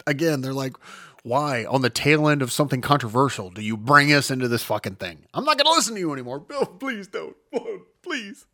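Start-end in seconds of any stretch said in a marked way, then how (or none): none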